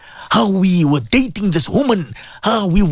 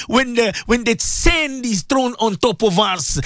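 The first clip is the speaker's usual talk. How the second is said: ordinary delivery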